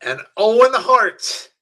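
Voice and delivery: high, stretched victory announcement; announcing the winner. Real, not acted. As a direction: draw out the big finish for the crowd. Announces the match finish.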